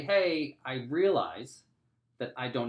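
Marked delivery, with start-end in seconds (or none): 0.0-0.6 higher, louder call across the room; yelling out. Retold or imitated. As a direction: shout hey across the office. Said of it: "and when I say".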